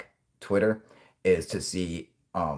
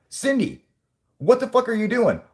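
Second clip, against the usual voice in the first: much higher and louder, snapping at her; furious boss; 6 dB louder than usual. Pretend. The boss yelling at Cindy at the Pentagon. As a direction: tear into Cindy.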